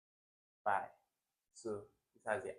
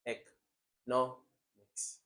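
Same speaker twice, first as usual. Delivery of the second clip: economics stretched out very slowly; slow teacher demo of economics; slow model; much slower than usual. Shown as a demonstration. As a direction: draw out economics for the class.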